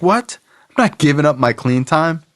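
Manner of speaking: high-pitched, disbelieving question; incredulous retort. Retold or imitated. Newcomer balking at giving up clean time, incredulous. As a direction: retell it as a disbelieving protest. Balks at sponsor's demand.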